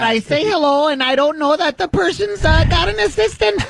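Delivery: high, airy mocking of Palin's voice; mock ditzy Palin. Host mocking Palin on the prank call, high ditzy voice. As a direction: play her as a flustered airhead.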